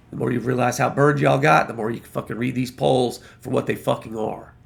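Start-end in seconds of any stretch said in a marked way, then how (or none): none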